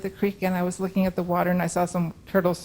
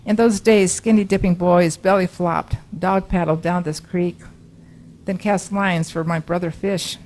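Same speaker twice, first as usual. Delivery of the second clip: louder, measured poetry recital, phrase by phrase; poem reading; 8 dB louder than usual. Acted. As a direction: read the monster's poem out to the room. Reads persona poem aloud.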